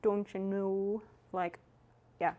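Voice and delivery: Canadian accent, slow, with the O in "know" drawn out. Shown as a demonstration. Streamer shows chat how to say the Letterkenny line, in a Canadian accent. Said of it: "You gotta draw out the O".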